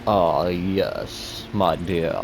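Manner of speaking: slow, grandly stretched vampire voice; classy Dracula voice. Shown as a demonstration. Streamer demonstrating his vampire voice to viewers, classy slow Dracula. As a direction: introduce yourself as a classy, accented vampire, taking your time. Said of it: "I do a pretty good Dracula voice".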